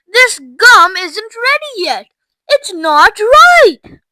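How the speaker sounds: high, slow, emphatic protest; urgent warning. A narrator's reading. Mister Wonka warning Violet the gum isn't ready, urgently. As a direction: plead loudly, stretching the key words.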